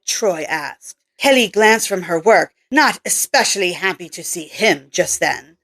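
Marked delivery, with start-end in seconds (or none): none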